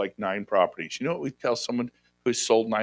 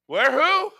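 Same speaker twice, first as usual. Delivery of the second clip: ordinary talk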